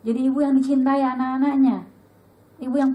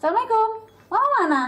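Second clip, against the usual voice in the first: put-on higher, louder child's call home; imitating her child; 8 semitones higher, 4 dB louder, faster than usual. Mother imitating her child calling out on arriving home, lightly voiced. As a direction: call out like a kid coming home.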